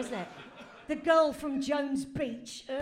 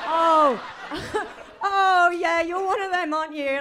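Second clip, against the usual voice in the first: much louder, laughing exclamation; laughing outrage; 5 semitones higher, 10 dB louder than usual. Acted. Gwendolen laughing loudly at Vincent's darling line. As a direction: burst out in amused scorn.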